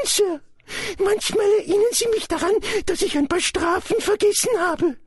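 very high-pitched elf voice; high, earnest creature voice; Dobby, dutiful house-elf. Acted. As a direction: explain it as a servile little elf.

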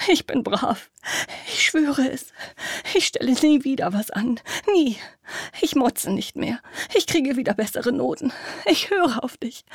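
high, loud desperate begging; pleading child. Acted. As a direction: beg mother like a terrified girl. Terrified girl begs mother.

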